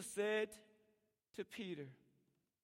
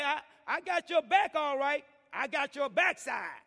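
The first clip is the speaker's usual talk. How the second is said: sly joke; 7 semitones higher, 12 dB louder than usual. Retold or imitated. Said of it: "I know you wanna say".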